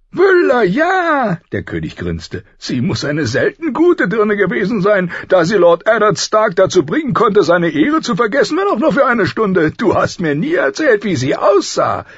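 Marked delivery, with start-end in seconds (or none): 0.1-1.4 high, loud, gleeful exclamation; delighted recognition. A narrator's reading. King finally recalling the woman's name, delighted. 2.6-12.1 high, booming, prying voice; teasing loud king. A narrator's reading. King needling his friend about the woman, teasing.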